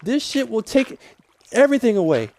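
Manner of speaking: half-acted fury at endless water; mock rant. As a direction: replay the moment you lost it, hammed up. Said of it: "And I'm just like".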